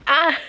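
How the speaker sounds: embarrassed squeal. Real, not acted. Reacting to the book.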